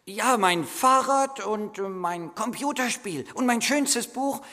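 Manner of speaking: high and loud, rushing in; boy cutting in, excited and high; eager boy interrupting. Acted. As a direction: blurt out the list of gifts. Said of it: "fiel ihm der Junge ins Wort".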